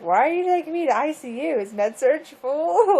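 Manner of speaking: high, startled retold question; surprised. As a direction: ask it half-laughing in disbelief. Retold alarmed question in ICU.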